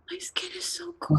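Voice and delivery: hushed ghostly whisper; faint whisper of a frightened ghost girl. Acted. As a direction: breathe it out like a cold ghost. Ghost girl speaking to Luis.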